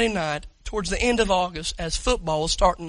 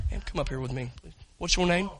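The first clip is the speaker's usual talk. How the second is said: ordinary talk, nothing marked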